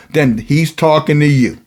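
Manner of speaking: ordinary talk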